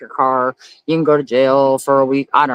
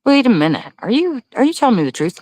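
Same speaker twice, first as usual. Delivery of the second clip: incredulous; 9 semitones higher than usual. Real, not acted. Reacting to a surprising comment.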